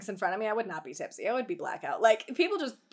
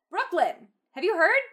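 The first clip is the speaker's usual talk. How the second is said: louder, higher called-out name; shouting; 6 semitones higher, 4 dB louder than usual. Acted. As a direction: call her name across the studio.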